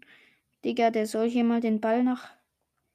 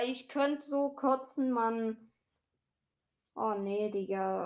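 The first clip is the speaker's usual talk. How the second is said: ordinary talk, nothing marked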